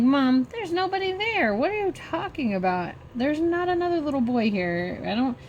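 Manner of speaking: high, incredulous pleading with a parent; baffled objection, voice pitched well up; retold disbelieving protest. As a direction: act out insisting she's seeing things, high-pitched.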